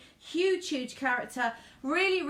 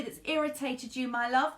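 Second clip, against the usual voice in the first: normal talking voice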